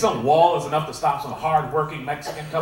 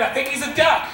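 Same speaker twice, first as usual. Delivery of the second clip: defiant declaration; 9 semitones higher than usual. Acted. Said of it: "That's right, I said it".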